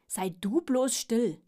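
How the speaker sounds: loud, slow, emphatic telling-off; annoyed pug snapping back; cross retort. Acted. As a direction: shut the other one up, leaning on each word. Pug quarrelling with the earthworm.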